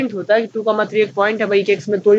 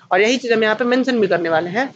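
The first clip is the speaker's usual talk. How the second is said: ordinary talk, nothing marked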